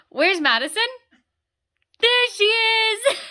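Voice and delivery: loud, high questioning, then drawn-out squeal of discovery; peekaboo game. Pretend. Mother pretending she can't see baby, excited peekaboo voice. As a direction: play peekaboo with gasping, exaggerated delight.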